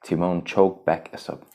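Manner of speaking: usual speaking voice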